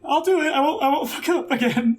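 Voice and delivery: a jittery reassurance; nervous promise. Acted. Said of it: "a shaky thumbs up".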